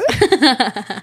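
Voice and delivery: high-pitched laugh; high, rippling giggle. Real, not acted. Laughs after admitting getting mad.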